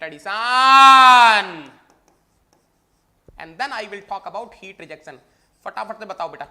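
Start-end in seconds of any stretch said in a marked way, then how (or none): 0.0-1.7 slow, loud repeat; shouted emphasis. Shown as a demonstration. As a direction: shout it slowly for the class. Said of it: "repeats louder".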